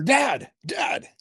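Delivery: high calling voice, imitating the son. Son on the ridge calling down to his dad, high. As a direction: call up like a kid from above.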